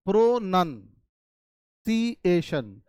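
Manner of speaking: प्रोनंसिएशन very slowly, broken into parts, with "nun" in the middle; syllable-by-syllable pronunciation. Shown as a demonstration. Teacher showing students how it is pronounced, प्रोनंसिएशन slowly split up. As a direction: stretch प्रोनंसिएशन out as pro-nun-si-ay-shun. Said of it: "इसका उच्चारण जो होता है".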